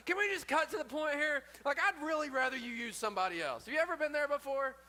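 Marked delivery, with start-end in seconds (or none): 0.1-3.7 Moses, exasperated. Retold or imitated. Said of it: "Moses is like".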